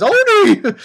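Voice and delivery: high, loud shout of Oni; startled high cry of Oni. Retold or imitated. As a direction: yell Oni like you've just seen a demon. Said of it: "She, she, she says".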